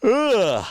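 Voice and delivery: a big, loud, high yawn; real yawn during the broadcast. Real, not acted. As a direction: yawn out loud mid-stream. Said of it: "Sorry for the yawn".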